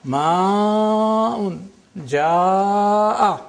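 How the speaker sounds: "Ma'un, ja'a" as maaaa-un, jaaaa-a; extra lengthening. Shown as a demonstration. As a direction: hold the long a in "Ma'un" and "ja'a" four to six beats. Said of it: "Either four vowels or six vowels".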